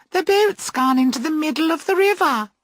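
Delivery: high, bright surprised exclamation; giddy girls noticing the drifting boat. A narrator's reading. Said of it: "are surprised".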